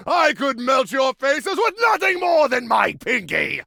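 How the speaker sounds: high, drawn-out hammy proclamation; grandiose, melodramatic villain shout; mock supervillain. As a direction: bellow it like a pretend evil overlord. Repeats villain bioterrorist act.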